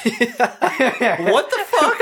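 high-pitched incredulous outburst; real baffled, giggly squawk; laughing disbelief. Real, not acted. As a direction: protest in astonishment through laughter. Astonished reaction to one-week timeline.

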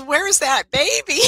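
loud, high, half-laughing question; hammed-up urgent demand to know. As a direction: raise the voice in comic urgency. Retold urgent question to her friend.

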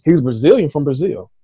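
usual speaking voice

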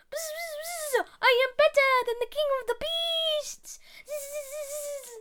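high, boastful buzzing fly; Fly bragging after the lion leaves, high and buzzy. Acted. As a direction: brag like a tiny cocky fly. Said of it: "a different one for Fly".

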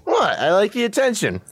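high, smug mock surprise; bratty what; cheeky bully. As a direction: brush off the scolding proudly. Bully answers back cheekily.

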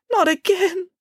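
put-on high, sighing child's complaint; exasperated sigh. A narrator's reading. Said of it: "sighed Samantha".

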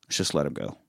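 soft, low coaxing to let them go; hushed plea. Retold or imitated. Van Damme quietly urging the guard to free hostages, hushed plea. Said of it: "he turns and looks at the guard and he says".

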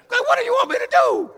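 high, exasperated voice of an annoyed God; mock irritated God. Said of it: "I think God was getting a little irritated".

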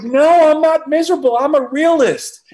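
high, loud indignant insistence; mock-indignant retort, high and loud; emphatic protest. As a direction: protest hotly with the pitch way up. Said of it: "And I'm like".

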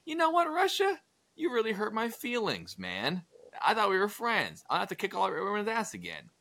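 high, whiny mock-hurt voice; hurt Napoleon. Napoleon whining to Russia about hurt feelings, high mock-hurt voice. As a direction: whine it like a wounded friend. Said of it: "So Napoleon's like".

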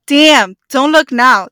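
loud "Damn" to a friend; playful loud heads-up; mock surprised whisper-shout. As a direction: alert her to a hot guy behind. Example sentence, acted surprise.

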